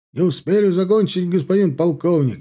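high, loud dutiful report; formal military report. A narrator's reading. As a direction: report stiffly to the colonel. Major reports again.

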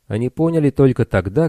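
usual speaking voice

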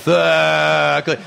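"th-" drawn into an overdone groaned uh; suono esagerato. Shown as a demonstration. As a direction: exaggerate the th- with a long moaning vowel. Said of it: "sto esagerando adesso". (gemito volutamente esagerato)

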